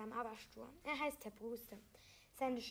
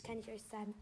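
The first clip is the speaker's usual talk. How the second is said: ordinary delivery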